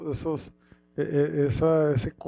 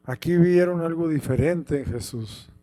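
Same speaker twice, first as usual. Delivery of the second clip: usual speaking voice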